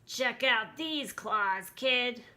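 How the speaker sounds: slow, rasped monster boast; rasping monster. A narrator's reading. Third monster shows off its claws, rasping. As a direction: rasp it like a tough creature. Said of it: "a third voice under the bed rasped".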